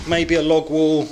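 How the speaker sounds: strained voice mid-lift; effort grunt. Real, not acted. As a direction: push the words out while lifting. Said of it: "I'm trying to move a log as I'm speaking".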